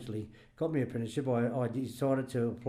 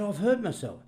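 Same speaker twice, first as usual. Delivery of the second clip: whingeing Dulcie; 8 semitones higher, 4 dB louder than usual. Retold or imitated. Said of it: "but she said".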